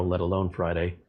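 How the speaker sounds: ordinary talk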